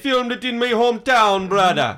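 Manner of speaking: high-pitched mock Jamaican accent. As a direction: chime in as a hammed-up Jamaican local. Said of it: "of Jamaica".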